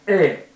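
えー as a long open "eh", not "ee". Shown as a demonstration. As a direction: say a firm, held えー for the letter E. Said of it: "いですけども".

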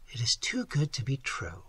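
hushed, softly sighed line. A narrator's reading. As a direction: sigh it out quietly in wonder. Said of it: "sighed Dorothy".

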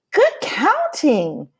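high, slow sing-song praise; drawn-out warm grandmother voice; grandmother's praise. Acted. Abuela praising Pablo's counting, slow and sing-song.

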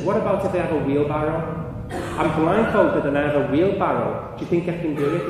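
loud, high, building challenge; acted calling out to spectators; retold shout. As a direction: dare the crowd like a showman. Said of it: "he's shouting at everybody".

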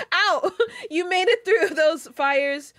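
high, loud, slow words through laughter; laughing. Real, not acted. Reader resuming a friend's birthday message, laughing hard. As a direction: read on while still cracking up.

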